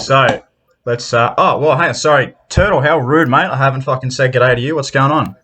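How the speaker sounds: normal talking voice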